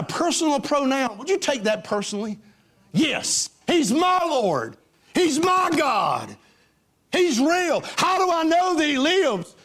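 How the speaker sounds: high, slow, ringing declarations; preaching at full force. Acted. Preacher testifying to the church, full force. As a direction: proclaim it to the church.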